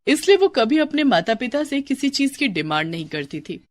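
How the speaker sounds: plain reading voice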